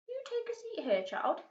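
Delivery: put-on a courteous old tramp offering a seat; Mister Stink. A narrator's reading. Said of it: "said Mister Stink".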